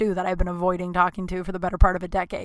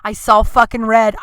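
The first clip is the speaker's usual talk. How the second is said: ordinary delivery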